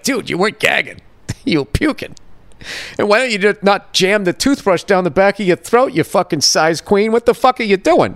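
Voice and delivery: ranting in amazed disbelief at the manager; incredulous rant. Real, not acted.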